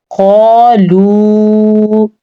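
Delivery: क़ालू with heavy q and long alif; heavy qaf. Shown as a demonstration. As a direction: say क़ालू thick and drawn out. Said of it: "खौफ को मोटा पढ़ेंगे, अलिफ मद्दा के साथ". (क़ालू को मोटा और लंबा)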